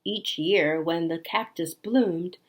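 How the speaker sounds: gentle, wistful storybook reading; story narration. A narrator's reading. Reading the ending to a child, tender storytelling. Reads picture book aloud.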